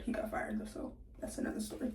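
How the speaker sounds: soft unvoiced gossip aside; whispered aside. Real, not acted. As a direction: drop to a secretive whisper.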